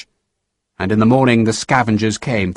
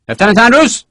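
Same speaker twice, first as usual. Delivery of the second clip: higher, louder officer's call across the field; Sharp calling out; 10 semitones higher, 5 dB louder than usual. A narrator's reading. Sharp calls to his lieutenant, raised.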